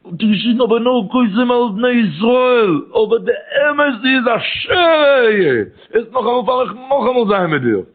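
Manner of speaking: high, drawn-out wailing chant; crying out. Retold or imitated. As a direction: cry it out slowly, stretching the key words. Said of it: "און דער געשריגן".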